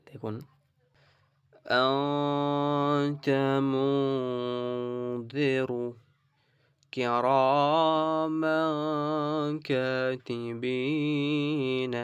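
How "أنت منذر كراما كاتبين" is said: Quran recitation. Shown as a demonstration. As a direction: recite each word long in full tajweed. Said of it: "আরেকবার ভালো করে পড়ে দিচ্ছি". (তাজবীদ সহ ধীরে তেলাওয়াত)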